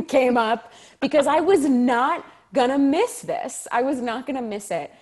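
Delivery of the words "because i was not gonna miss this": loud insistence leaning hard on "not"; emphatic determination. Real, not acted. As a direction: punch "not" and "miss" with stretched, raised emphasis. Insists she would make the audition.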